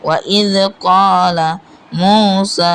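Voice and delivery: slow, with long vowels in "ক্বালা" and "মূসা"; "ক্বালা মূসা" recited with held vowels; one-alif elongation. Shown as a demonstration. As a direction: stretch "ক্বা", "মূ" and "সা" evenly. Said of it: "এক আলিফ পরিমাণ টান হবে".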